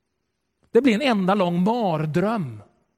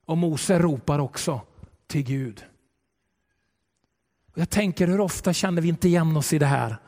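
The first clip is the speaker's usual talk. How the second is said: ordinary delivery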